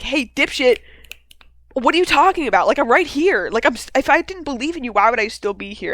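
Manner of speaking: lightly acted indignant retort; mock exasperation. Podcaster voicing Arthur arguing back at Dutch, mock exasperated.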